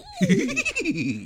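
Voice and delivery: high cartoon dog snicker; a wheezy cartoon dog laugh; Scooby-Doo laugh. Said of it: "that's how he laughs".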